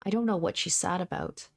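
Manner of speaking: usual speaking voice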